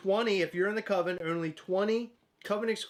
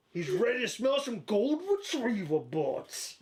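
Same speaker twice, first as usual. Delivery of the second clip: slow, slightly higher cartoon hound; Huck voice; 3 semitones higher, slower than usual. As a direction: drag it out lazily. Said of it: "how the pros do it".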